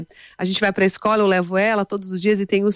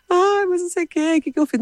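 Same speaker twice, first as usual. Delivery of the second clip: high-pitched imitated child's protest; whiny child; 10 semitones higher than usual. Scolded daughter protesting to her mother, imitated whiny voice. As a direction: protest like a scolded little girl, mock whine.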